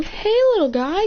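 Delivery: put-on high-pitched baby-animal talk; cooing greeting. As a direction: coo to a tiny creature.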